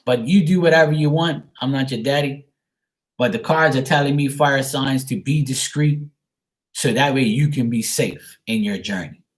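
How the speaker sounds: ordinary delivery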